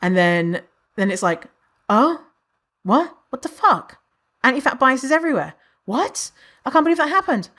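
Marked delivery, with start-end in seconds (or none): 1.9-7.5 high-pitched, appalled double take; acted disbelief. Newcomer finding anti-fat bias everywhere, acted disbelief. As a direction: act out dawning shock in short bursts.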